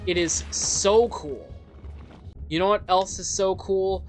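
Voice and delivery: usual speaking voice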